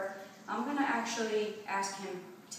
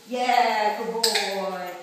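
loud dog praise; 11 dB louder, much slower than usual. Real, not acted.

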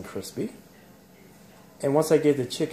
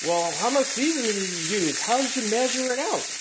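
lightly voiced curious viewer, higher; a hint of a nosy viewer asking, pitched up; 8 semitones higher than usual. Mimicking a viewer who asks about the seasoning, mildly higher. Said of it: "some people may ask".

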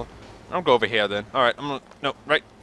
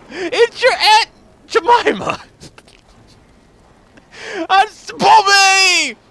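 hammed-up yelling for Bobby; 5 semitones higher than usual. Begging Bobby for a push, hammed-up desperate yelling. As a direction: bellow for Bobby like he's drifting away. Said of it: "that voice".

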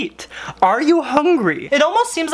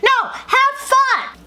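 much higher, drawn-out shriek; mock shriek; much slower than usual. Wife shrieks at John to have fun, much higher and drawn out. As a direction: explode at him in mock fury. Wife's final outburst.

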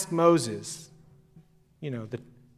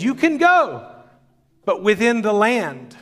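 much higher, grand mock Pharaoh voice; haughty Pharaoh; 5 semitones higher, 3 dB louder than usual. Preacher quoting Pharaoh to Moses, high and haughty. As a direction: play Pharaoh granting a grudging permission. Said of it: "And Pharaoh says".

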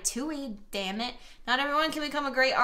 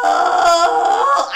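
long, loud, higher-pitched groan; frustrated groan; 5 semitones higher, 13 dB louder than usual. Real, not acted. Player regretting what the game did, frustrated groan. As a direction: let out a big exasperated "oh".

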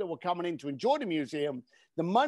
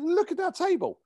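much higher, eager pitch to impress; excited; 12 semitones higher than usual. Retold or imitated. Host pushing the curator to admire it, excited and high. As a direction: sell the view like a proud host.

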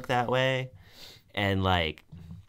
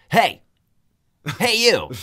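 sudden high, loud mock call; abrupt comic shout as a dog; 10 semitones higher, 8 dB louder than usual. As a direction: blurt it to grab someone's attention. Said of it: "look up at you and just be like".

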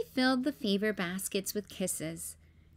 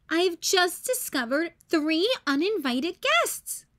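high, louder, slow sister voice; indignant Ruby; 7 semitones higher, 3 dB louder than usual. A narrator's reading. Ruby reports the uninvited guests to Grandma, indignant. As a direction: announce the culprits with mock outrage. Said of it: "said Ruby".

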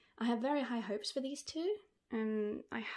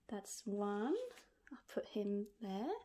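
normal talking voice

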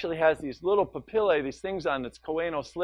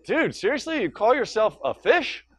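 mocking gunnel; 5 semitones higher than usual. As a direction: tease him like a surfer buddy. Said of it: "The gunnel's like".

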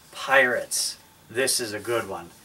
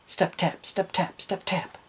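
quick run of pairs without pauses, higher; running steps; 6 semitones higher than usual. A narrator's reading. Captain running on his peg leg, hurried patter. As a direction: patter the words along like running feet. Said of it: "Then he ran".

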